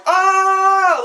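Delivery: loud, high-pitched mock scream; imitated scream. Reviewer imitating the son randomly screaming at the bad plug, mock scream. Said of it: "he just screams and goes".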